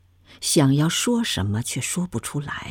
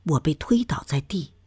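ordinary delivery, nothing marked

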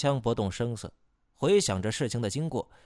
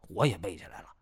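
dopey deep voice; 3 semitones lower, much faster than usual. Acted. Second helper answers.

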